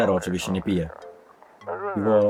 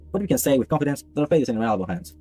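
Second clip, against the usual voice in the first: higher-pitched, measured announcer voice; radio news report; 8 semitones higher, faster than usual. Acted. Newsreader announcing a new sign of visitors, formal. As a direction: read it out like an official bulletin.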